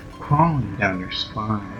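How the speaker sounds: ordinary delivery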